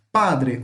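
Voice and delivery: PADRE hit firmly on the first syllable; stress on PA. Shown as a demonstration. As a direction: punch the PA of padre, let "dre" fall. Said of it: "o pá é mais forte".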